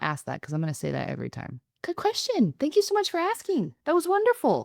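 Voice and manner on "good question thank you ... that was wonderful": high-pitched, gushing thanks; exaggeratedly gracious. Shown as a demonstration. Host shows how to thank the questioner, high gracious voice. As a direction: thank them in a much higher, overdone polite voice. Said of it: "that's gracious".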